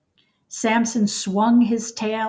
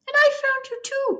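much higher, fast squeaky cry of joy; excited cry; much faster than usual. Acted. Mouse answers Samson in delight, high excited cry. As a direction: burst out in delight. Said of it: "cried the mouse".

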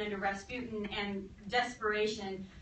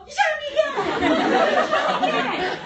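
high, loud disbelieving yell; hammed-up outrage, breaking into laughter; outraged shout; 12 dB louder than usual. Rasputin shot again, yells at killers.

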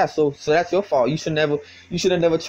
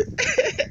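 short soft high giggle; 3 dB quieter than usual. Real, not acted. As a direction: let a squeaky giggle slip. Laughing mid-sentence.

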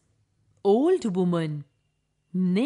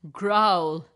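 tiger growl; 3 semitones lower, 5 dB louder than usual. Acted.

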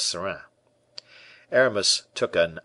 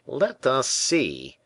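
slow, drawn-out doubting tone; a tailor who doesn't believe a word; the tailor, slowly incredulous; 6 semitones higher, much slower than usual. A narrator's reading. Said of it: "said Percerin incredulously".

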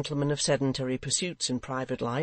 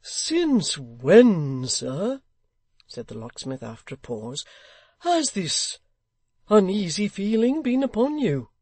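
locksmith voice; 6 semitones higher, 6 dB louder than usual. Acted. Locksmith asks.

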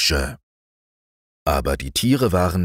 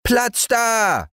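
put-on high, bossy child's yell; boy yelling make way. Häwelmann charging into the stars, high bossy yell. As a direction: barge in like a spoiled small boy. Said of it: "schrie Häwelmann".

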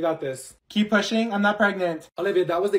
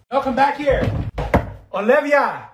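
calling out; 5 semitones higher, 4 dB louder, slower than usual. Acted. Yelling for friend leaving.

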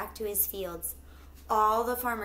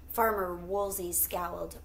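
ordinary delivery

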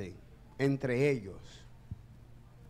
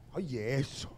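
normal talking voice

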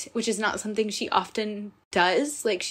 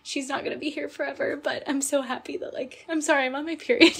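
high, tearful voice breaking; apologizing to listeners while tearing up, high and crying; 7 semitones higher than usual. Real, not acted. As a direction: speak through real tears. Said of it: "listen to my crying voice".

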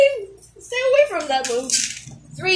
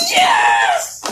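sudden high yell of triumph; excited shout; 13 semitones higher than usual. Real, not acted. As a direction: shout it out, thrilled. Cheering a Beyblade battle win.

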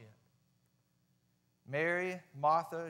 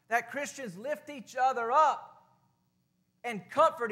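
raised, emphatic preacher cadence; preaching; 5 semitones higher, 5 dB louder than usual. Acted. Pastor stirring the congregation, raised preaching. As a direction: build it up like a pulpit call.

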